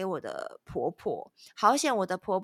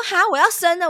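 high, loud startled "I'm giving birth"; shocked cry; 10 semitones higher, 9 dB louder than usual. Retold or imitated. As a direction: blurt it out in alarm, pitched up. Retold shock at labour news.

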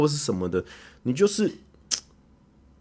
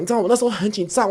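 ordinary talk

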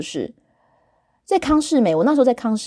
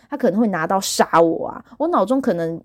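normal talking voice

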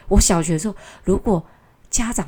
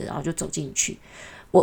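ordinary delivery

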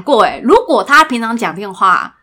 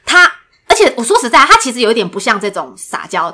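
ordinary talk

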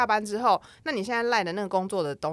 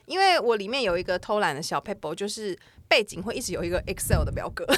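ordinary talk

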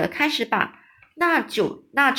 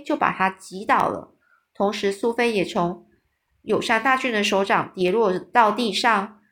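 usual speaking voice

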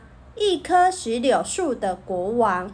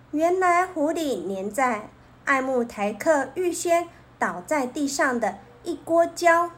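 ordinary delivery

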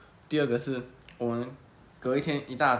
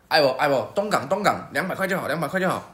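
louder barker calling out fares; touting driver; 3 semitones higher, 5 dB louder than usual. Retold or imitated. Unlicensed driver touting passengers at the station, loud calling out. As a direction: hawk the ride like a street tout. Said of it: "他说".

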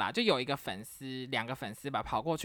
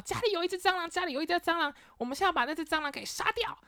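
put-on much higher, urgent kid-sister voice; excited little sister; faster than usual. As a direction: squeal the cockroach alarm. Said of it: "她就跟我说".

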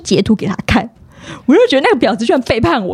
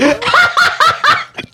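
laugh; 14 semitones higher than usual. Real, not acted. Laughs after reading comments.

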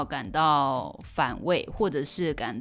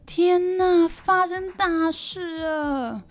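alarmed husband; 12 semitones higher, 5 dB louder, much slower than usual. Retold or imitated. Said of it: "他就跟我说".

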